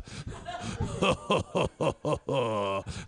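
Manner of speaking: long, measured "ho ho ho" anime laugh; anime main-guy laugh. Pretend. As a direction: put on a grand anime laugh. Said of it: "That's the main guy".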